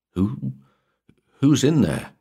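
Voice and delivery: high, hesitant, frightened question; wavering. A narrator's reading. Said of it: "his voice wavering".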